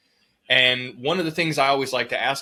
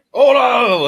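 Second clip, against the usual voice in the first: high, very loud call to stop; imitated shout; 12 semitones higher, 12 dB louder than usual. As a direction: yell it out as the retold boss. Boss calling out at a fight.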